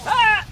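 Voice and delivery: high, loud startled shriek. Real, not acted. Gamer caught off guard, high startled shriek. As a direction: shriek at a sudden scare. Sudden scare in game.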